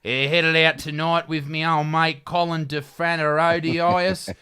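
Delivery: broad Australian accent with "tonight" said as "tonoit"; broad bogan Australian accent. Shown as a demonstration. Told to read the caption like a bogan, broad Australian accent. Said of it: "You gotta talk like a bogan".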